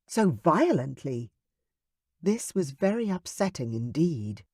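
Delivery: usual speaking voice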